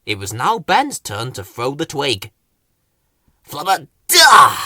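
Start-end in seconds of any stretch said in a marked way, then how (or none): 3.5-4.6 loud gruff Flowerpot gibberish shout; grunted. A narrator's reading. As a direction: bark the nonsense word out loud. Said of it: "he grunted".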